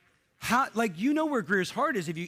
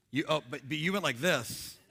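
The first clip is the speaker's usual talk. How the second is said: normal talking voice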